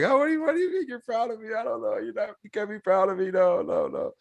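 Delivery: pleading through sobs, pitched high; tearful high-pitched protest to dad; bawling. Retold or imitated. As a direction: break down crying in disbelief. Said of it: "I start, I start bawling".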